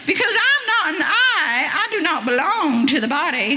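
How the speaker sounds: put-on high, twangy American Southern accent. Preacher voicing the ear in an American Southern accent. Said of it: "very American Southern".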